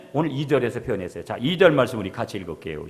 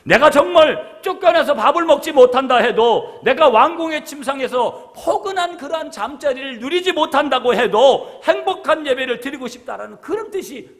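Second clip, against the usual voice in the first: louder, rising preacher's longing; fervent preaching; 12 semitones higher, 9 dB louder than usual. Acted. Pastor preaching to the congregation, fervent and loud.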